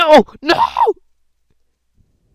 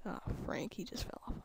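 whisper; 11 dB quieter, faster than usual. Real, not acted. Aside while playing.

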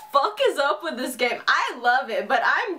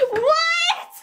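much higher, indignant, laughing cries; outraged squeal; 12 semitones higher than usual. Real, not acted. Player furious that the date walked off, squealing high. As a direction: shriek your disbelief at the game. Outraged when Bakugo leaves.

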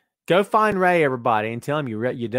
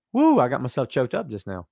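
ordinary talk, nothing marked

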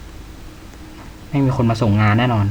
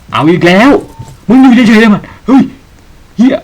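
higher, much louder frightened shout; panicked shout; 8 semitones higher, 9 dB louder than usual. Real, not acted. Streamer attacked again, shouting in panic. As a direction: burst out yelling in real fear.

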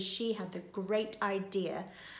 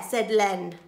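usual speaking voice

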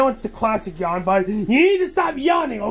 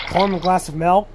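usual speaking voice

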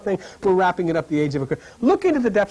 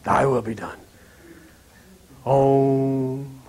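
much lower, hushed mantra voice; solemn intoning; 8 semitones lower, much slower than usual. Pretend. Preacher to audience, solemnly intoning the prayer.

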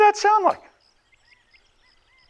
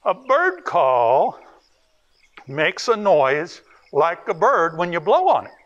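ordinary delivery

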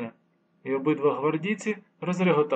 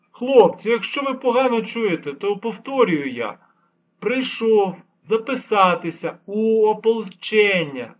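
slow, loud, spelled-out patience; like explaining to small children; 6 semitones higher, 8 dB louder than usual. A narrator's reading. Old man to deaf-seeming guards, slow and insistent. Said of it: "мов малим дітям втовкмачував".

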